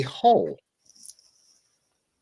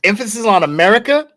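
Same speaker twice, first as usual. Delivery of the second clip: America punched higher and louder, pitch rising at its end; stress on America; 4 semitones higher, 4 dB louder than usual. Real, not acted. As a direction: hit "America" higher, leave it hanging upward.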